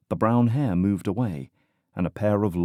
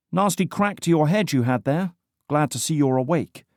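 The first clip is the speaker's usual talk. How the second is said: higher, brighter British woman voice; louder, smiling British; 8 semitones higher, 3 dB louder than usual. A narrator's reading. British woman cheerfully greeting the injured man. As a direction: chipper and amused. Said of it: "Louder, she said, smiling".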